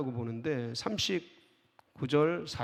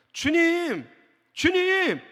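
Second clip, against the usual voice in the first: high, loud pleading cry; frantic cry for help; desperate crying out; 8 dB louder, much slower than usual. Acted. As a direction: call out to him in panic. Disciples crying out acted.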